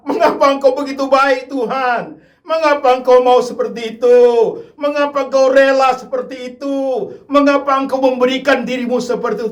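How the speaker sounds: high, loud pleading cry to God; anguished loud prayer. Acted. A tearful believer at the cross cries out to the Lord, loud and pleading. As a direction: cry out the question, overwhelmed with gratitude.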